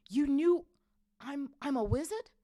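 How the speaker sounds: high, loud disbelief; astonished boy. A narrator's reading. Harry learning he's a wizard, astonished.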